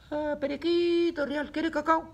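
high squawky parrot voice, far above her own. Pretend. Teacher voicing the parrot for her pupils, high and squawky. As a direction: squawk it like a pet parrot begging. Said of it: "El loro dice".

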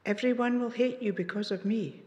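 usual speaking voice

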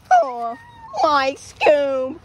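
Shaggy impression. Imitating Scooby-Doo characters.

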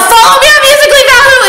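high, loud, fast shout out the window; yelling. Retold or imitated. Girl yelling at a driver with his window down, high and loud. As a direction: yell it at a passing car. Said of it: "so I yelled".

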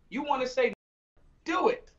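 normal talking voice

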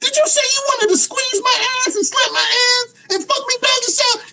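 shrieking like an offended woman, hammed up; going off on him. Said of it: "Erotic hypocrite's gonna go off on you. She gonna be like".

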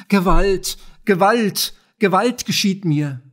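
loud, high cry of protest; frantic cry. A narrator's reading. Old poultry seller protesting to the inspector, crying out frantically. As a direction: cry out in senseless outrage. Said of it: "So schrie sie sinnlos mit ihrer heiseren Stimme".

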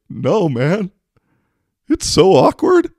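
very high, loud mocking whine; whiny drunk guy. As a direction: squeal it like the embarrassed drunk guy.

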